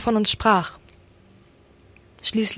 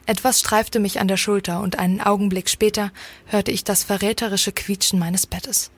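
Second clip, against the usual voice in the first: ordinary delivery, nothing marked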